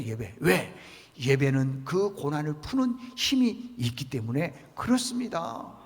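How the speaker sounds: ordinary talk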